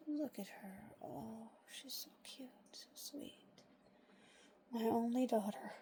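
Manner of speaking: soft hushed whisper. Real, not acted. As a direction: breathe it out so she stays calm. Whispering over the resting dog.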